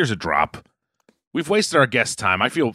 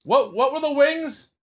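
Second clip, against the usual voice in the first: high, raised call through a door; yelling through a door; 14 semitones higher, slower than usual. Real, not acted. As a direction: yell to someone in the next room. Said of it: "[name] is yelling through the door".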